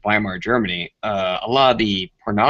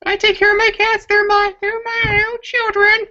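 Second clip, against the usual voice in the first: very high, put-on cat mom voice, much higher than usual; mock cat lady. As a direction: play a doting cat mom cooing over her pets, squeaky and high.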